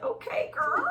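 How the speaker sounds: put-on high, laughing "okay girl"; squealing approval through a laugh; impressed okay girl. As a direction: cheer her on, way up high. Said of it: "I was like".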